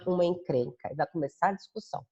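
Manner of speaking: ordinary delivery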